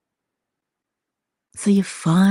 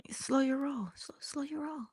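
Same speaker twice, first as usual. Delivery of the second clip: soft, higher-pitched mock parent easing a teen back; hushed parental caution; 8 semitones higher, 14 dB quieter than usual. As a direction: rein the kid in very softly. Parent's reply voiced to teen.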